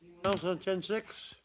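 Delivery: talking with food in mouth; mouth full. Real, not acted. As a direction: say it around a mouthful. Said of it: "Was my mouth full?".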